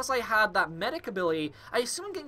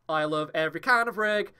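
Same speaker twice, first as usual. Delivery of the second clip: normal talking voice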